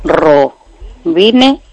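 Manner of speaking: slowly repeating a name back. Shown as a demonstration. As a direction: sound it out carefully. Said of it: "repeating the product name".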